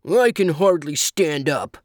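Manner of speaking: gruff creature grumble; grumbling. A narrator's reading. Creature complains it can hardly stand, grumbling. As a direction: grumble as a put-upon animal. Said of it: "grumbled the monkey".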